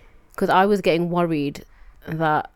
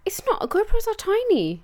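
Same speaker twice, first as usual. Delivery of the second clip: high-pitched protest. Real, not acted. Playful disagreement, high pitch.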